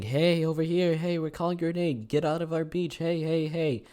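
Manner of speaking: high-pitched calling out to taunt someone; mock yelling. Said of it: "We start yelling at him".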